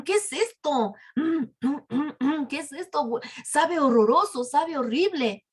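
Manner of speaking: acted crowd protesting in dismay; disgusted complaint. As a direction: react to a horribly bitter mouthful. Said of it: "la sacaron de su boca y dijeron".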